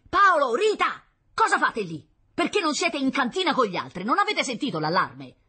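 high, loud shout; calling out loudly. A narrator's reading. Father calling to his children on the balcony, shouting. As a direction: shout up at them in alarm. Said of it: "chiamò a gran voce".

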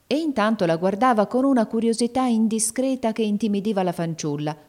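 usual speaking voice

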